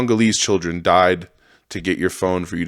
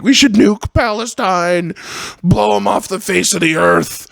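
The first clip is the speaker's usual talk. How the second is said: much higher, slow mocking radio rant; mock Howard Stern; 13 semitones higher, 3 dB louder than usual. Mocking Stern's nine eleven radio rant, high and slow. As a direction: ham up a shock-jock hot take. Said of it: "Remember Howard Stern on the morning of nine eleven was like".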